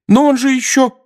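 very high, loud outburst; agitated protest. A narrator's reading. Mrs Weasley's shouted argument goes on. (громко, высоким голосом)

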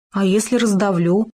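plain reading voice

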